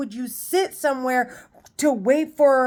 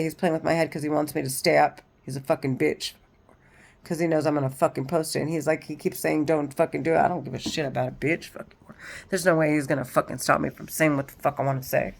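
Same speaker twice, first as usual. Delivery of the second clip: low, soft, fast angry muttering; muttered aside; 7 semitones lower, 6 dB quieter, faster than usual. Real, not acted. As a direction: grumble it under your breath, much lower and quieter. Muttered aside about him.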